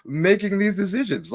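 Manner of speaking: high, loud exasperated rant; screaming at the TV. Retold or imitated. Fed-up voter at home yelling at officials on TV, loud and high-pitched. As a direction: yell it like a protest at the television.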